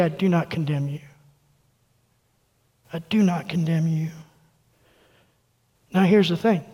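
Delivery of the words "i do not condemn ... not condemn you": much softer and lower, tender; hushed, tender promise; tender Jesus voice. Retold or imitated. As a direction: say it gently, like forgiving someone face to face. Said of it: "let him look you in the eye and say".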